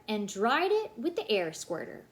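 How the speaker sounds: usual speaking voice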